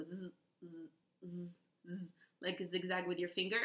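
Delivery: a long soft buzzed "zzz" like a zigzag; buzzing zzz. Shown as a demonstration. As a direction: buzz the z while drawing a zigzag. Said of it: "I can show you".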